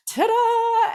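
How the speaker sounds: triumphant ta-da. Pretend. Ending her tale of the man with a loud triumphant ta-da. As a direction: belt it out like a magician's reveal.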